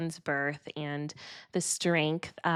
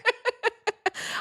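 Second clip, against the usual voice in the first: bright laugh. Real, not acted. Host laughs at compliment.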